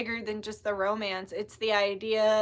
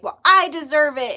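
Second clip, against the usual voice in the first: mock-entitled, louder and higher; rude, entitled attitude; 6 semitones higher, 12 dB louder than usual. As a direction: punch it out like a demanding brat. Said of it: "like, a rude attitude".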